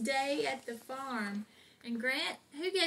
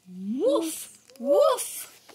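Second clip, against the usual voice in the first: rising louder woofs; mock bark; 5 semitones higher, 7 dB louder than usual. Mom barking as Biscuit for her son. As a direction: bark like an excited puppy.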